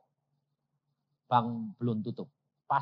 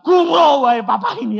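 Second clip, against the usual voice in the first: loud, high, laughing outburst; laughing shout; 12 semitones higher, 16 dB louder than usual. Retold or imitated. Son shouting with laughter at his father, loud and joyful. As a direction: burst out laughing in delight.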